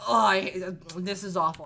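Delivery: low, mock-dismayed ancestor; dismayed groan. Upset ancestors react to these syllables, low mock dismay.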